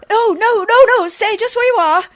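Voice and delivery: high, loud, flustered stop; alarmed protest. Acted. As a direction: panicky plea to keep him away. Bathing woman stops man approaching.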